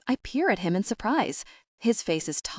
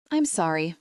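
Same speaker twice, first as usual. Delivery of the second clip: usual speaking voice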